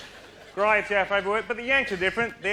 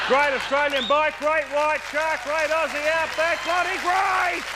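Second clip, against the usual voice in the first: higher pitch, climbing to the last great; mounting enthusiasm; 8 semitones higher, 3 dB louder than usual. Acted. Comedian climaxing a list of great things, mounting enthusiasm. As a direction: build the list to an exultant peak.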